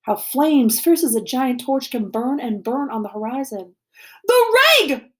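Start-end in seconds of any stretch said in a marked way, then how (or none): 4.3-5.2 high, loud cry of horror; alarmed shout. A narrator's reading. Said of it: "Bear shouts".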